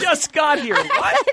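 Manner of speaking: very high, laughing innocence; high mock innocent voice. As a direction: play dumb in a very high voice. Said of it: "acting out Chris".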